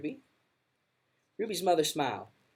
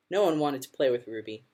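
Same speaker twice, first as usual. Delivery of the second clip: usual speaking voice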